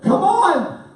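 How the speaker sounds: high, loud exasperated cry; frustrated. Retold or imitated. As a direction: burst out at the slow worker. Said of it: "kinda frustrated".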